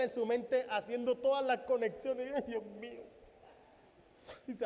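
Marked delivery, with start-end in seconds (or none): none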